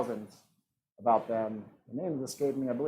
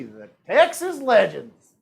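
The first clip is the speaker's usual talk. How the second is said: slow, louder Texas twang; playful, higher Texas accent; 4 semitones higher, 6 dB louder, slower than usual. Pretend. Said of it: "That's not a bad Texas accent".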